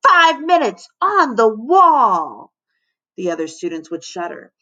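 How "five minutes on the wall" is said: slow bellow, pitched high then falling. A narrator's reading. Lunch monitor sentencing a kid, bellowing. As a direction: pronounce sentence in a drawn-out bellow. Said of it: "Missus Bunns would bellow".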